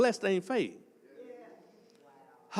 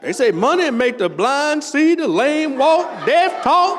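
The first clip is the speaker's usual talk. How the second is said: rising preacher's cry, louder and higher; hammered sermon cadence, slow on the lame walk; preaching shout; 5 semitones higher, 7 dB louder, slower than usual. Real, not acted. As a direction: build to a full-voiced shout. Preaching over money and miracles.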